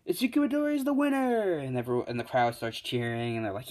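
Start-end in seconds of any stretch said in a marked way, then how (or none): 0.1-1.6 very high, quick announcer cry; winner announcement. Acted. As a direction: sing out the result to the crowd.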